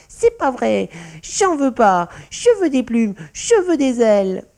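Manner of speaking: high, loud tantrum; angry child's yelling. A narrator's reading. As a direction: yell like a little one stamping her foot. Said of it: "elle tapait du pied en criant". (crise de colère enfantine criée)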